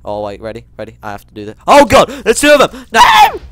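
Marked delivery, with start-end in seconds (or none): 1.7-3.4 high, loud scared yell; panicked shout. Real, not acted. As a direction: shriek in real panic.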